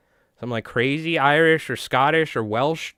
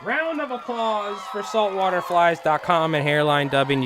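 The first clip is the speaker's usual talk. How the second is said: high announcer voice hyping the crowd; announcer call; 7 semitones higher than usual. Pretend. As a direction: boom it out like a game show host. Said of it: "calling for applause".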